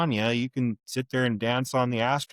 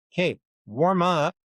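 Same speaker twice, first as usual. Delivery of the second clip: slow, drawn-out mock advice; sarcastic slow retort; dismissive; 7 semitones higher, 3 dB louder, slower than usual. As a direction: spell it out slowly. Retold confrontation, dismissive.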